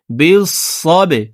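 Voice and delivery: normal talking voice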